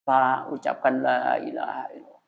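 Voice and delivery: soft breathy whisper at the bedside; whispered guidance. Retold or imitated. Relative whispers the creed into his ear, hushed. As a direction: whisper the words to a dying man. Guiding a dying man softly.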